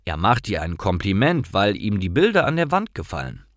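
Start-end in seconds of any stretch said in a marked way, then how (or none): none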